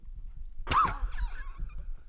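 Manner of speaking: a very high squealing laugh; shrieking laugh. Real, not acted. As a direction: burst out squealing. Laughing at mom's fall.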